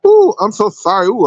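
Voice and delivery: ordinary delivery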